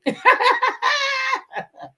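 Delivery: shrieking laugh; high squealing burst of laughter; real high-pitched laugh. Real, not acted. Laughing at her own joke.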